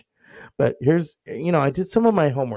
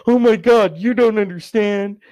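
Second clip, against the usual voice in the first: much higher, louder indignant whine; whiny cousin; 7 semitones higher, 7 dB louder than usual. Retold or imitated. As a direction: play the offended cousin. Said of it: "he was like".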